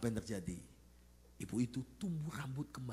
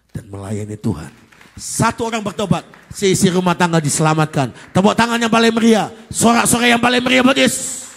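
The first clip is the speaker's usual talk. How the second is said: shouted, much louder and higher; full-force preaching; 8 semitones higher, 25 dB louder than usual. Real, not acted. Pastor calls congregation to cheer for Jesus, shouting. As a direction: rouse the crowd to applaud.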